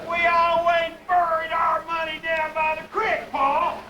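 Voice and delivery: Pa mocking his son's words; mocking echo. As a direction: throw his words back at him, outraged. Pa mockingly echoes son.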